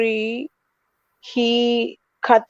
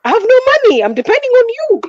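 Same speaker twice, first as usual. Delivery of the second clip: desperate pleading; 13 semitones higher, 4 dB louder than usual. Retold or imitated. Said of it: "And I'm like".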